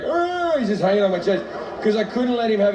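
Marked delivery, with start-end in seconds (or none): 0.0-0.6 put-on high, drawn-out dangling-baby noise; mock toddler drone, pitched way up; imitated child's groan. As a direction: let out a long, high mindless cry. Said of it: "he's just hanging off me like".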